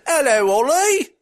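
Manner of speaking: a bad English accent greeting; hammed-up English accent; mock English accent. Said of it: "that English accent is absolutely terrible".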